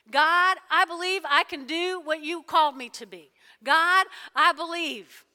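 loud, ringing declarations of faith; full force preaching. Real, not acted. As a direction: proclaim each line like a sermon climax.

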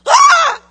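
sudden high, very loud yell; hammed-up shriek of shock; startled scream. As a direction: burst out screaming at full force. Acts shock at God talking loud.

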